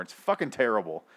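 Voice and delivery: usual speaking voice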